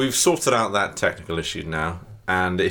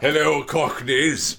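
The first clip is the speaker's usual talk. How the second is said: slow, slightly raised princely greeting; prince in disguise; 6 semitones higher, slower than usual. Acted. Mimics disguised prince greeting Cockneys.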